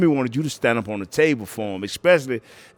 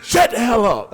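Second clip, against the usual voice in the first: mock snap; 11 semitones higher, 7 dB louder than usual. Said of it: "That's what Eric was telling him".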